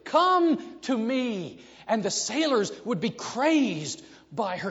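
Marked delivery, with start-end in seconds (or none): 0.0-1.6 slow, high, drawn-out luring call; siren's call. Retold or imitated. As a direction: sing it out like a siren luring sailors. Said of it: "gorgeous voices that called to the sailors".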